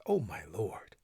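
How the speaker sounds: hushed, breathy plea to God; a soft whispered cry to the Lord; whispered prayer. Acted. Noah prays to his Lord.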